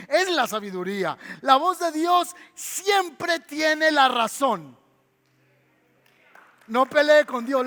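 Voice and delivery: full preaching. Acted. Preacher proclaiming to the congregation, loud and emphatic. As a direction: drive each line home from the pulpit.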